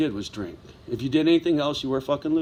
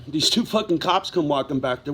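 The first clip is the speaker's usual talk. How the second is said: ordinary talk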